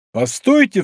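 a sharp, high cry to stop; exclaiming. A narrator's reading. D'Artagnan stops Bezmo with a sharp cry. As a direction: cut him off with a call. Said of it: "вскричал Д'Артаньян".

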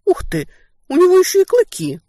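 high, loud amazed exclamation. Acted. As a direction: marvel out loud at the fangs. Surprised at seeing fangs.

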